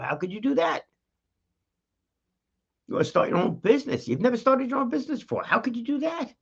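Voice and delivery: high, quick mocking voice of a doubter; doubting skeptic. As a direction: needle them: how could YOU do that.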